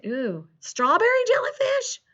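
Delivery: high, intrigued rising question; hopeful guess. Pretend. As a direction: ask with hammed-up wonder. Farmer guesses it is food.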